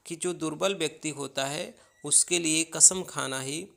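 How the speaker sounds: ordinary delivery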